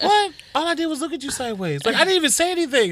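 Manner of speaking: high, fast whiny protest; kid's indignant protest. Retold or imitated. Kid protesting to his mom, high fast whiny protest. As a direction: protest your innocence like a kid.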